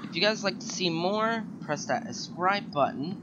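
loud upbeat sign-off; YouTube outro. Acted. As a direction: pitch the subscribe button. Outro call to subscribe.

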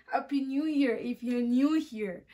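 slow, chuckling repeat of the wordplay on "new here"; laughing at own pun. Shown as a demonstration. Vlogger repeats her pun to viewers, laughing. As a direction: lean on "new" and laugh through the line. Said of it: "Did you guys get that?".

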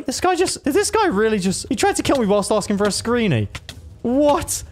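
high-pitched, incredulous outrage; indignant disbelief. Real, not acted. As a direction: exclaim up high, affronted by the betrayal. Indignant at sneak attack.

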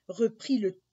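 plain reading voice

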